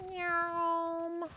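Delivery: high, drawn-out meow; cat meow. Pretend. Meowing as a cat.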